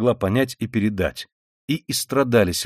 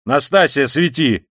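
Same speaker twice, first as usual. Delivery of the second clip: loud, higher summons; calling out; 5 semitones higher, 6 dB louder, slower than usual. A narrator's reading. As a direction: call to the maid. Calling to the maid. (окликая, громко)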